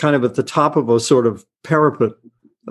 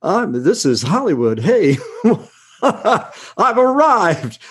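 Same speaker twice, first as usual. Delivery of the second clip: giddy wonder; 4 semitones higher, much slower than usual. Retold or imitated. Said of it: "and I'm going".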